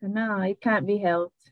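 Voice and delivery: It can't be helped said slow and deliberate; slow English. Shown as a demonstration. Translating shogunai into English, slow. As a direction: spell out It can't be helped slowly. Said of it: "English shogunai would be like".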